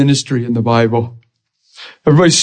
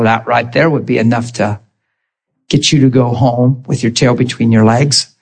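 ordinary talk, nothing marked

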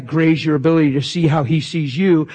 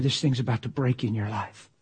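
usual speaking voice

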